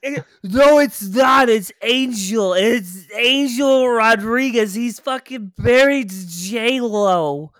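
loud, high, drawn-out mock insistence; stubborn arguer impression. Imitating the ex insisting on the wrong name, mock-stubborn. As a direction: mimic a pig-headed arguer, loud and slow. Mimics ex's stubborn argument.

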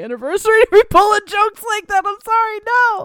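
high, loud plea, half laughing; on the verge of tears. Real, not acted. Apologizing over jokes near the anniversary, mock-tearful. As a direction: voice climbing as if holding back tears. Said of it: "I'm not gonna cry".

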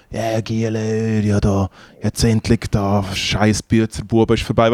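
soft, drawn-out start, low-key; lightly voiced laid-back stage manager; imitated stage manager. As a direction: imitate him quietly, stretching the first words. Quotes the stage manager to helpers.

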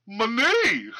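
mock drawled, high and laughing; weird southern twang. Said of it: "With, like, this weird accent".

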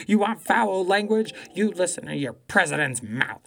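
imitating an old lady telling off a Trump supporter; retold fiery retort. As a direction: throw the line back at her hard. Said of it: "And she was like".

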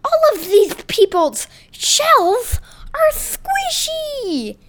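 high, slow cartoon voice; Taco voice. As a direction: draw it out in a squeaky kid voice. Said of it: "said Taco".

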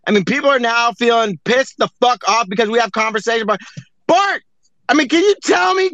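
usual speaking voice

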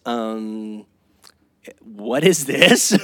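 a drawn-out, doubtful wife asking slowly; low and slow, a mild mock frown; puzzled wife. Wife unpacking the bag, slow puzzled question. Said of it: "she says".

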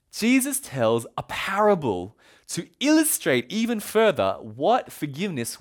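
ordinary delivery

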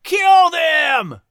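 enraged mob voice; angry shout. Acted. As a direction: scream it at the redcoats. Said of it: "other angry voices echoed".

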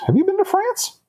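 bright high curious voice; high chirpy question. Acted. Maria asking Yova about travels, high and bright.

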